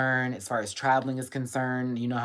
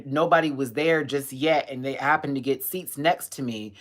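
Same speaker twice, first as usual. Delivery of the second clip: ordinary talk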